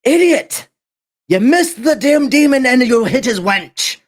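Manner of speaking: high, furious bark at a blunder; angry yell. Acted. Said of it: "you hear Darius's voice".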